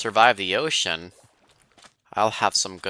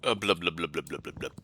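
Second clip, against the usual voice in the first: shouting underwater; 2 semitones lower, 5 dB quieter than usual. Pretend. Swimming underwater, mock bubbling noises.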